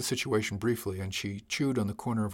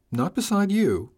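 surprised; 9 semitones higher, 7 dB louder, slower than usual. Acted. Chief startled by the seat placement.

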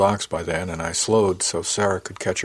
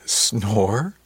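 incredulous; 2 semitones higher than usual. A narrator's reading. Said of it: "she asked incredulously".

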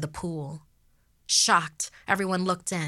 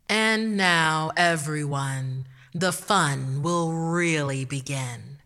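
loud, slow mic announcement; creepy showman on stage; sinister announcer; 5 dB louder, slower than usual. Acted. Masked host announces on mic.

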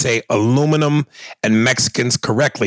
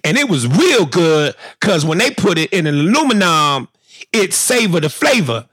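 rising higher and higher, Magic Johnson voice; Magic Johnson impression; 4 semitones higher than usual. Host does Magic Johnson, climbing higher. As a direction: imitate Magic Johnson's cheerful pitch climbing.

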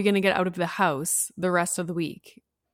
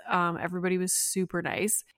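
ordinary talk

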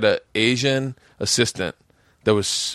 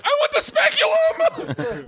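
very high, drawn-out comic wail; hammed-up terrified squeal; mock panicked shriek; slower than usual. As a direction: shriek it like a desperate plea, half laughing. Retells his panicked change of choice.

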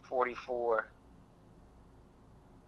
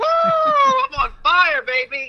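yelling it out, high and loud; triumphant cheering shout; excited shout; 8 dB louder, slower than usual. Real, not acted. Contestant celebrates a correct answer.

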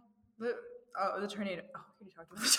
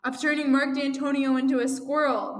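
loud, raised story-villain shout; acted shout; 5 semitones higher, 9 dB louder than usual. A narrator's reading. Phone voice accusing the listener, shouting. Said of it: "the voice shouts".

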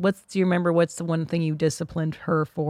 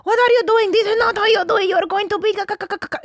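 mock a parent panicking over a mistake; 13 semitones higher, faster than usual. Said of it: "the panicking".

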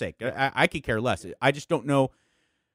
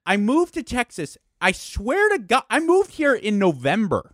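excited disbelief; 6 semitones higher, 3 dB louder than usual. Real, not acted. Disbelief at the job's timing.

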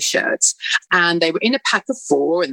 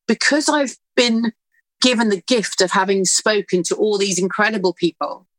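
usual speaking voice

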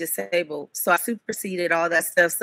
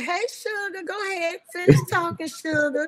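put-on country-talking little boy; 11 semitones higher than usual. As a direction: imitate a small boy's country twang. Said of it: "He talks like he's from the country".

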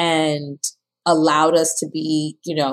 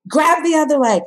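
high, commanding doctor voice; imitating the doctor barking orders; doctor giving orders; 8 semitones higher than usual. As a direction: direct the family like a drill sergeant. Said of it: "she directed my mom and she was like".

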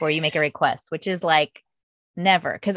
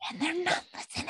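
much higher and softer, a wry put-upon echo; mock exasperated echo; 11 semitones higher, 11 dB quieter, faster than usual. Mom echoing her frustration about the kids, mock despairing. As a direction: lift it high and breathe it out, half-joking. Echoes the complaint, high and soft.

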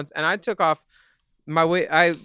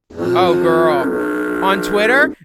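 loud, thrilled outburst; excited; 6 dB louder, much slower than usual. Real, not acted. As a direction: gush about it loudly. Excited exclamation over magazine feature.

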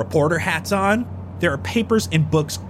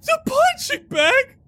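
high character voice. Acted. High-pitched bag NPC.

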